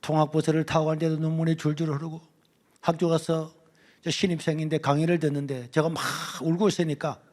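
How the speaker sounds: ordinary talk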